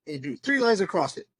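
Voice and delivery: usual speaking voice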